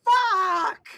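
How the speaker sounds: loud, high alarmed curse; panicked yell. Retold or imitated. Rory reacting to the beast attack, loud panicked yell. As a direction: shout it in alarm.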